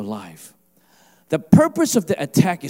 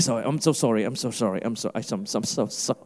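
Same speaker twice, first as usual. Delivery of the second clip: lower, softer, meek mock apologies; Canadian-style apologizing; 7 semitones lower, 6 dB quieter, faster than usual. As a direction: apologize over and over, trailing softer. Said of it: "A typical Canadian".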